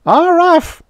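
very high, loud two-beat alien cry; natives' shriek. A narrator's reading. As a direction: shriek it joyously. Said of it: "shrieked all three natives".

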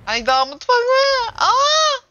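high, loud hammed-up whimper over the kitchen; mock crying wail. Streamer facing the kitchen boxes, mock crying wail. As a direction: pretend to burst into tears, hammed up. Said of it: "Vallahi oturup ağlayacağım şimdi".

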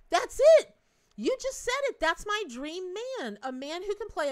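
loud, high-pitched delighted exclamation; excited outburst. Real, not acted. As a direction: burst out as if you've found the one. Sudden delighted outburst at viewer's suggestion.

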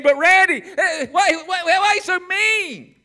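high, loud mock whine; whiny objector. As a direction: play the complaining listener. Voicing a whining listener.

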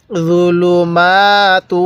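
"জুলুমাতু" slow, with long maa and tuu; measured recitation. Shown as a demonstration. Teacher showing how letters join, slow recitation. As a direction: draw out the end of জুলুমাতু. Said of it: "তা লাগবে ওয়াওয়ের সাথে".

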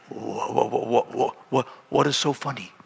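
low, quiet, stuttered question; stunned stammer. Retold or imitated. Husband to giggling pastor after the fight, baffled stammer. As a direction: imitate a husband too baffled to get it out.

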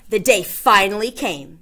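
ordinary delivery, nothing marked

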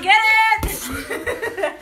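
ordinary talk, nothing marked

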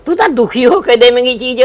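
usual speaking voice

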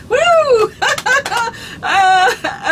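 high whooping laugh; loud elephant whoops and laughs; elephant laughter. Pretend. Second round elephant laughter.

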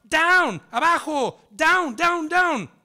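very loud, high barked commands; shouted order. Acted. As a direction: bark it up at the boy on the fence. Border patrol orders boy down.

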